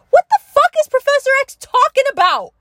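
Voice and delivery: high, loud disbelief; what the hell is he on about; incredulous outburst. Real, not acted. Rants to listeners about Professor X, in loud disbelief.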